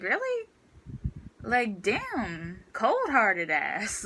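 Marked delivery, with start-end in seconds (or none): 0.0-0.5 "Really?" jumps much higher in stunned disbelief; shocked really. Retold or imitated. Student to cold teacher, shocked he won't let her go. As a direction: hit "Really?" high, as if you can't believe it. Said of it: "I was so shocked".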